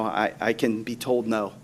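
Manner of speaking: usual speaking voice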